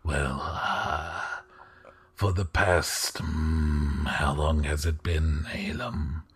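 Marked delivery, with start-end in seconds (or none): none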